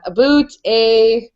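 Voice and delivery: slow "A boot" with oo for ow, then "a" as eh; "A boot" rhyming with toot, "a" sounding like eh; Canadian "a boot, eh". Shown as a demonstration. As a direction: stretch out "A boot, a" as Canadian samples. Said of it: "But there are Canadians, we do it all".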